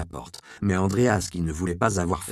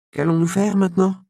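apprenti inquiet, bien plus aigu et plus fort; demande-le avec une peur légère; voix apeurée; 11 semitones higher, 7 dB louder than usual. Acted. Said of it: "demanda-t-il d'une voix apeurée". (Robin, encore secoué, interroge son maître d'une voix apeurée)

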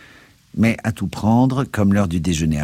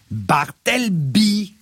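raising the voice; 10 semitones higher, much slower than usual. Acted. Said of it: "dis-je en élevant le ton".

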